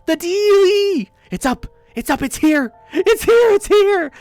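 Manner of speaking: very high, loud frantic screaming; ecstatic shrieking. Acted. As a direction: shriek with uncontainable excitement. Mail arrives, character beside self.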